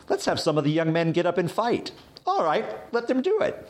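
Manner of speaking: high-pitched mock reading of the text; comic reading. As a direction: read it like a silly, breezy exchange. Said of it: "it just almost reads sort of comically".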